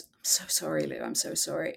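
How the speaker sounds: soft retold apology. Best friend hugging her on the bathroom floor, hushed and sorry. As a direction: murmur the apology gently, barely above a breath. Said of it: "She goes".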